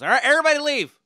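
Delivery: high, loud announcement to passengers; shouted order. Retold or imitated. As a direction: call out to the whole bus. Driver empties the bus.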